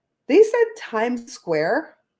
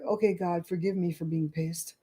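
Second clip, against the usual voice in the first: murmured prayer; 3 semitones lower, 9 dB quieter than usual. Retold or imitated. Quiet prayer to God.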